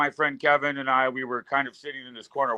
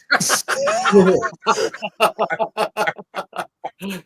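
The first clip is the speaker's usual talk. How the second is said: long, high burst of laughter; 6 semitones higher, 4 dB louder than usual. Real, not acted. Guest cracks up over the knee pads story, laughing hard. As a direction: crack up at length. Reaction to his own blunder story.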